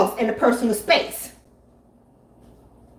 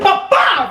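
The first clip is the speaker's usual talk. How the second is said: quick higher mock punch sounds; punch noises; 8 semitones higher, 4 dB louder than usual. Comedian showing the punch, mock punch noises. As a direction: pop out two punch sounds.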